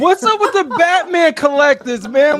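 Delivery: loud, high-pitched comic complaint; mock outrage. Guest ribs collectors about distant camera, in loud mock outrage. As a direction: call it out in exaggerated protest.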